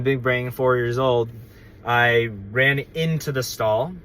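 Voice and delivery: normal talking voice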